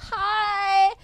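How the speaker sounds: high, loud, drawn-out mock "hi"; over-friendly greeting. Student greeting a lecturer like a friend, mock over-friendly. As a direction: call out an overfamiliar, gushing hello.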